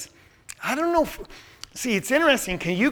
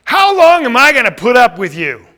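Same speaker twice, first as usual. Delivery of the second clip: loud, raised, fed-up demand; louder, higher, at the end of patience; exasperated outburst; 5 semitones higher, 9 dB louder than usual. Shown as a demonstration. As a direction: burst out with impatience. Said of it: "Is that a total different message?".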